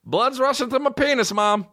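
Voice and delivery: put-on high-pitched, proud-sounding little-kid call; imitated kid announcing. Cousin walks through kitchen telling mom, high mock-kid announcement. Teller repeats kid's announcement.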